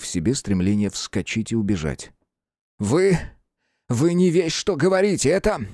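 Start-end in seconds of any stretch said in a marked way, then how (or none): 2.8-5.7 high, indignant outcry; agitated exclamation. A narrator's reading. Cornered hunchback denying the murder, exclaiming in agitation. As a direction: protest in a raised, stammering voice. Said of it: "воскликнул он".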